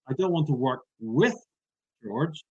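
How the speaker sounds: "with" pushed up and held before a pause; rising, emphatic "with", pause, then the rest; stress on WITH. Shown as a demonstration. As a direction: lean on the word "with". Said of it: "I change the emphasis".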